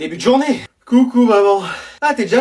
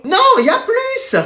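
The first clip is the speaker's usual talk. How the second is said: high teasing no; 12 semitones higher than usual. Acted. Teacher teasing.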